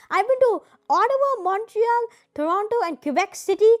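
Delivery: loud, high-pitched go at a Canadian accent; attempted Canadian accent. Shown as a demonstration. Listing Canadian cities visited, in an attempted Canadian accent. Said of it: "trying to say this in a Canadian accent".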